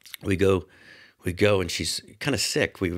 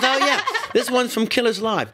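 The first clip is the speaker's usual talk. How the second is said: high-pitched, louder mock of an older rocker; 5 dB louder than usual. Imitating [name] proudly naming the drum kit. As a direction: pipe it up brightly. Quoting [name]'s cheerful answer.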